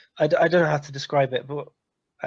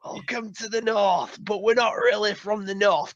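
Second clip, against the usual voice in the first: slow, drawn-out northern Yorkshire voice, laughing at the end; a northerner being picky about the North; mock Yorkshire accent; 3 semitones higher than usual. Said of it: "different Yorkshire accents".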